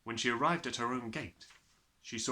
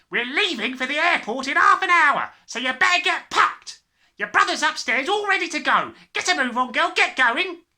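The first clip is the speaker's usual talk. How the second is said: loud, much higher bellowing father; gruff father; 16 dB louder than usual. Acted. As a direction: bark it out like a hurried father.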